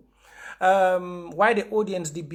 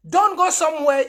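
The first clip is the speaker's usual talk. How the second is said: angry outburst; 8 semitones higher, 7 dB louder than usual. Real, not acted. Angry appeal to followers.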